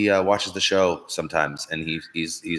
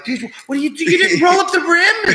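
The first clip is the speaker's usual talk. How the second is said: much higher, squealing panic; mock freaked-out bartender; 5 dB louder than usual. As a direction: imitate the frantic bartender, pitched way up. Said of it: "She's like".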